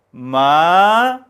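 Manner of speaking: 마 climbing from re up to sol; second tone. Shown as a demonstration. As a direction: slide 마 steadily upward. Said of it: "레부터 솔까지 쭉 올라가죠".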